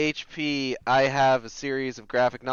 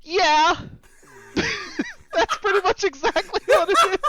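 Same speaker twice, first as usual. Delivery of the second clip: high, loud, laughing agreement; helpless laughter breaking into the words; cracking up; 5 dB louder than usual. Real, not acted. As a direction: squeal it out through real laughter. Cracking up at the comparison.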